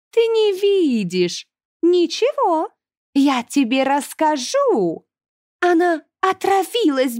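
high, slow, singsong villainess; gloating high-pitched demoness; creepy demon. A narrator's reading. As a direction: taunt with mock pity, stretching every phrase. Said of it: "жуткий хохот демона".

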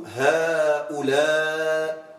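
هؤلاء drawn out slowly, the final long aa held before the hamza; هؤلاء with the madd held long; long madd ending. Shown as a demonstration. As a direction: stretch the last vowel of هؤلاء. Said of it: "تقول".

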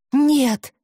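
loud, sharp high outburst; blurted out. Acted. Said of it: "выпалила Хести".

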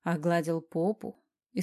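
plain reading voice

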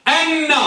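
أن said an-na, no opening la; teacher's model of أن, doubled n; initial lam dropped. Shown as a demonstration. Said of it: "بحذف اللام".

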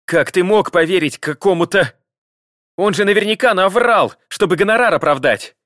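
high, loud indignant cry; wife feigns outrage at her husband's trust in detective; outraged wife. A narrator's reading. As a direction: shriek the wronged innocent.

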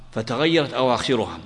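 ordinary delivery

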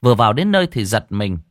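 ordinary delivery

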